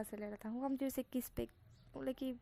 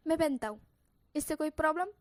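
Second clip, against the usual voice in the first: louder, defiant snap; 5 semitones higher, 8 dB louder, faster than usual. Acted. Wing defends his underwear, defiant and louder. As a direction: stand up for yourself. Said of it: "मुझे गुस्सा आ रहा है".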